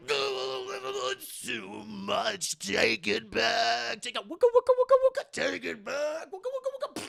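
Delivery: high, warbling electrocution cries; comic overload panic with buzzing wooka noises; overloaded villain. Acted. Electro overloaded with bounced-back electricity.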